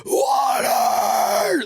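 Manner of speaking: choking man screaming at his wife for water; screamed SpongeBob plea. Retold or imitated. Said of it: "Like SpongeBob asking for water, but like, scream it".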